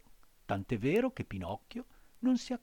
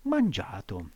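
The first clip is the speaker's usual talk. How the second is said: ordinary delivery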